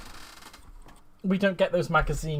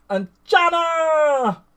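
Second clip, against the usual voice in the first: high, loud, long-drawn hail; delighted greeting; 14 semitones higher, 8 dB louder, much slower than usual. Real, not acted. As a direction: call out the name in a big sing-out. Calling out to arriving viewer.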